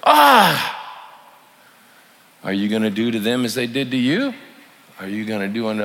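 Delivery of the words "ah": loud high squawk of disgust; outraged squawk. Retold or imitated. Diner fed up with the waiter, loud high mock squawk. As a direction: let out a shrill mock-offended cry.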